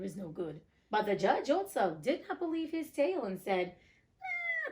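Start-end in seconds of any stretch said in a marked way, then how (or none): 4.2-4.7 much higher drawn-out "Ah"; a high knowing ah; judge's exclamation. Acted. Judge reacts, very high and drawn out.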